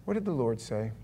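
ordinary talk

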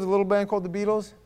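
usual speaking voice

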